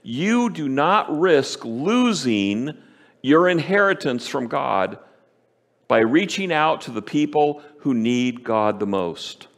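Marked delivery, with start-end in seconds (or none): 0.0-5.0 slow, weighty words, each one landed; slow, deliberate, preacher's emphasis on not; emphatic. Real, not acted. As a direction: stress do not risk losing, drawn out. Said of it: "Can I say it any more emphatically?".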